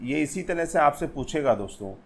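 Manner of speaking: ordinary talk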